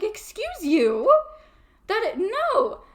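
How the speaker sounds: high, slow, offended; drawn-out offended scolding; sassy indignation. Retold or imitated. Shadow figure throws her plants, offended high protest.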